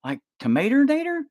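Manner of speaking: high puzzled voice, climbing on tomato; confused friend. Pretend. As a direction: act baffled. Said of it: "And you'll be like".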